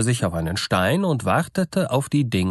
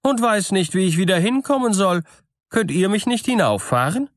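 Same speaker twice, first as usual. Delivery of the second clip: lightly voiced sly farmer playing the fool, higher; farmer's voice; 10 semitones higher than usual. A narrator's reading. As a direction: play innocent, fallen from heaven. Husband pretends to be fool. (schlauer Bauer, der den Narren spielt, höher)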